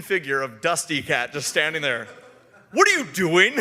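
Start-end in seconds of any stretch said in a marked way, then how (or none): 2.7-3.5 loud, raised-pitch demand; comic loud disbelief; mock challenge. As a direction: call it out from the doorway. Imitates onlooker challenging them from doorway.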